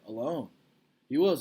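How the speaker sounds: ordinary talk, nothing marked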